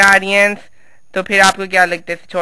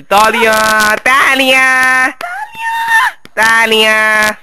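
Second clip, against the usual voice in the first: loud drawn-out call for claps; calling for applause; 7 semitones higher, 5 dB louder, much slower than usual. Acted. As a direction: stretch it out, rally the crowd.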